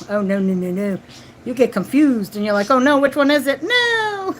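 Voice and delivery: usual speaking voice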